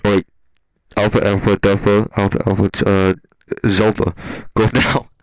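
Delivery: loud barked radio orders; commanding agent. Retold or imitated. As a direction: call in the strike team. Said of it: "Someone at YouTube's like".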